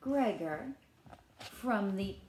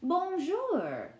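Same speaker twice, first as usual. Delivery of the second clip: French hello; 8 semitones higher, 3 dB louder than usual. Shown as a demonstration. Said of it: "He says".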